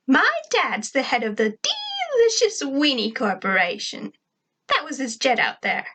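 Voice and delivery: high, put-on girl voice; high voice with stretched, swooping words; Tack. Tack's high character voice.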